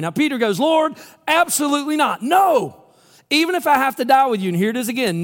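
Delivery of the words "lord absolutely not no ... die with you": Peter's indignant shouted refusal; emphatic shouted vow. Acted. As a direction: shout the promise in disbelief. Peter vows to Jesus.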